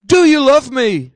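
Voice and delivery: firmly projected voicing of Jesus; insistent retold question. Jesus asking Peter a second time, insistent.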